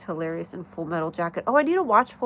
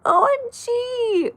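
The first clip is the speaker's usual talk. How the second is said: shocked gasp; 5 dB louder, much slower than usual. Real, not acted. Gasps at the chat idea.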